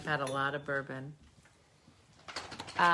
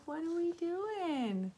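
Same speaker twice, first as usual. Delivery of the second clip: high, slow, sleepy dog voice; a lightly whiny pet, much higher; voicing the dog; 10 semitones higher, 7 dB quieter, slower than usual. Voicing Booby the dog, high sleepy whine.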